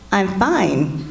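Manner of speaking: clipped, loaded fine with a laugh; curt fine meaning not fine. Retold or imitated. Wife to husband, loaded I'm fine. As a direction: say fine but mean the opposite. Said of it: "And I say".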